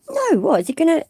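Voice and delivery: high-pitched shocked "No, what?"; outraged disbelief. Real, not acted. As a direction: squeak out disbelief that he's walking free. Outraged at cop letting go.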